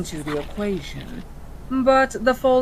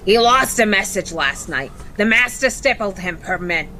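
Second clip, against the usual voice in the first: cockney imp voice; 4 dB louder, faster than usual. Acted. Imp speaks in role.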